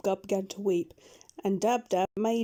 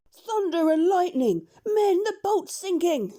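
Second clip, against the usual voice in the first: loud, much higher bellow; bellowed alarm; 10 semitones higher, 6 dB louder than usual. A narrator's reading. Pirate captain bellowing to his men that the boat sinks. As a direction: roar out that the boat is sinking. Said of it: "suddenly bellowed out".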